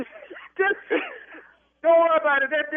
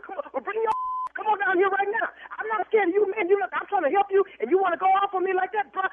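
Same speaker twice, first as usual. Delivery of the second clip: high, heated comeback, daring him to come; angry challenge; 9 semitones higher than usual. Real, not acted. Business owner daring a threatening caller, heated and high. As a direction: rise up and dare him to show up.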